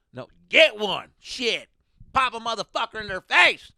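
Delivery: loud, high mock yell; hammed-up Texan driver pushing a gun; rowdy driver. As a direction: bark it like the gun-crazy driver. Voicing the driver, gun advice.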